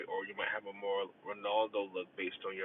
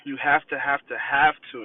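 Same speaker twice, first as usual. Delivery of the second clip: emphatic; 4 semitones higher, 10 dB louder than usual. Real, not acted. Emphatic point to guys.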